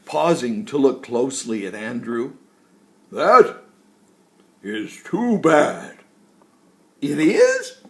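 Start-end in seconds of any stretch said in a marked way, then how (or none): 3.1-6.0 put-on loud, jolly old tree voice; woody tree voice. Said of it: "deep, woody-sounding words came out". 7.0-7.8 high, loud surprised yelp. Acted. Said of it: "yelped Andrew in surprise".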